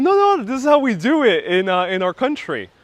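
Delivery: mother insists it's normal; imitating the mother. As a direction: mom brushing off the kid's worry. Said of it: "And she was like".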